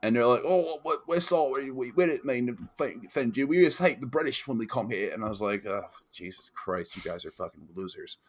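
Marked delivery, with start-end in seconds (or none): 0.5-5.1 higher-pitched, vaguely British apology; botched Northern Irish accent. Retold or imitated. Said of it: "they're all just British accents to me". 5.7-8.2 low, soft, weary dismissal; quiet aside of contempt; muted disgust. Retold or imitated. Retold weary dismissal of the men.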